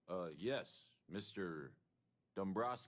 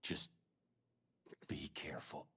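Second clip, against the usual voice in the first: whisper; 4 dB quieter than usual. Acted. NPC warns, fearing being overheard.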